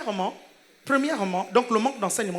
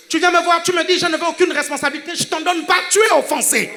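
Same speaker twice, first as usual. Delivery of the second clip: loud, raised voice in hammered parallel phrases; full-force preaching; 4 semitones higher, 8 dB louder than usual. Real, not acted. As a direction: hammer each refrain at the congregation. (prédication martelée à pleine voix)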